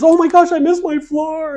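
very high, loud mock panic; hammed-up high-pitched exclamation; exclaiming lady. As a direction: imitate a flustered woman wailing, last words drawn out. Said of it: "She goes".

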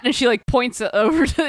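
ordinary delivery

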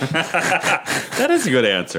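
said through a laugh. Real, not acted. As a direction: laugh while saying it. Laughing at drag queen answer.

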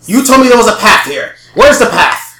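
loud, high, furious shouting; angry yelling. Acted. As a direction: yell for the scout, enraged. Villain yells for the scout.

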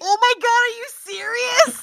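mock excited. Friend reacting to nerve being kept.

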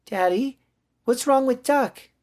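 put-on very high, childlike question; high small-child voice of concern; worried little girl. Girl worried about toy.

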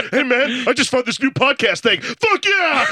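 excited night-owl greeting bursting into a high cheer; hyped-up buddy sharing a discovery; wide-awake enthusiasm. Acted. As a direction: build from chatty to an exuberant yell. Acting friend wide awake at night.

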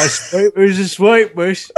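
hammed-up Cockney, drawn out; bad Cockney accent. Shown as a demonstration. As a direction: drag out a dodgy Australian-turned-Cockney accent.

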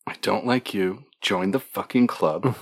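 low, soft grumble; quiet, dry aside; muttered reading to self. Acted. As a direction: read it under the breath, unimpressed. Player character reads note quietly.

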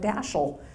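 "Daschle" said DASH-ul, stress up front; TV mispronunciation. Retold or imitated. Granddaughter quoting what people on TV kept saying, mispronounced "Daschle". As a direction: say "Daschle" as newscasters do, rhyming with "bashful" minus the f. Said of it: "people on T V kept saying things like".

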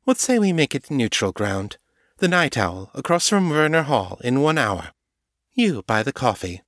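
lightly sketched Morgan, amused; Morgan's voice. A narrator's reading. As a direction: propose the meeting with easy charm.

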